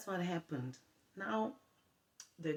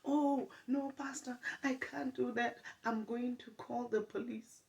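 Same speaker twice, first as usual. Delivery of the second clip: much higher mock girl's voice, refusing; 9 semitones higher than usual. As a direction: squeak it out high, refusing the pastor.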